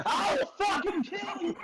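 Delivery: very high, slow, shouted threat; furious shout. Real, not acted. Player screaming a mock threat at friends.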